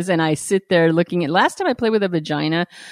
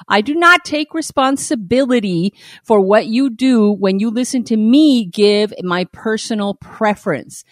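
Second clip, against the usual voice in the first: ordinary talk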